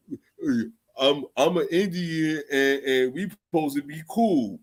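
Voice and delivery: slow put-on mocking voice of the debater; mocking impression. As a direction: parody him in a slow put-on voice.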